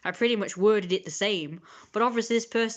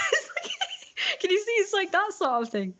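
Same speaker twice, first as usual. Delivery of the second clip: high laughing words, breaking up; giggling hard while trying to talk; 11 semitones higher than usual. Real, not acted. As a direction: laugh through the words, much higher. Laughs at own story.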